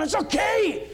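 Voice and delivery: high, loud burst of impatient reassurance; mock exasperated shout. Passenger grabbing the pilot's microphone, mock exasperated shout. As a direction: shout it over the pilot's fretting.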